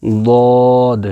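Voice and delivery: ضاد stretched long and held, like dictating to a class; drawn-out Arabic letter name. Shown as a demonstration. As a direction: say ضاد slowly, holding the vowel. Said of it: "ایسے dot ڈالیں گے".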